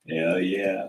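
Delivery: low, drawn-out hell yeah; deep hell yeah. Acted. Voiceover, deep catchphrase.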